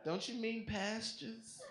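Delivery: put-on high, slow American-accented query. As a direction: correct him in an American accent. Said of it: "with my English accent".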